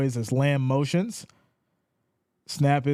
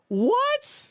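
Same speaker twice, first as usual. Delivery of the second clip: high squeaky disbelief; can't believe the call; stunned yelp; shocked what. Real, not acted. Reacting to penalty call.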